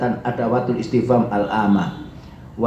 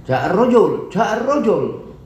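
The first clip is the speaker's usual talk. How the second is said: "ja'ar rojul" pitched high, then falling on rojul; "ja'ar rojul" as a high, marvelling exclamation; exclamation of wonder; 9 semitones higher, slower than usual. Shown as a demonstration. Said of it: "Atau ta'ajubiyah".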